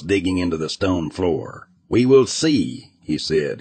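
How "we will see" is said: determined. Acted. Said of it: "his voice filled with determination".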